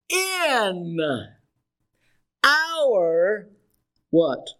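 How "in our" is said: "in" and "our" each held long, set apart by pauses; stress on IN OUR. Shown as a demonstration. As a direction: stretch out "in", pause, then "our". Preacher prompts congregation on key words.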